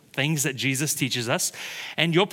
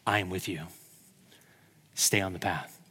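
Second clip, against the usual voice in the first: softer, lower, tender words; God's gentle voice; 10 semitones lower, 8 dB quieter than usual. Retold or imitated. God reassuring the pastor on his walk, tender and soft. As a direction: speak it as the Lord's quiet reassurance. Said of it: "The Lord drops in my heart".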